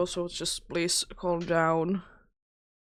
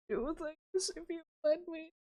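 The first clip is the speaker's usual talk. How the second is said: high, soft voice for the cat; pleading with the cat in a high soft coo; 11 semitones higher, 5 dB quieter, slower than usual. Real, not acted. Cooing at the pushy cat.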